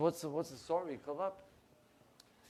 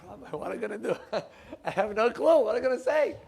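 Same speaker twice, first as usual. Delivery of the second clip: high, loud, laughing panic; nervous laughing plea; 7 semitones higher, 8 dB louder, faster than usual. Retold or imitated. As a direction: laugh helplessly, with no idea what to say. Panicked man calls his rabbi.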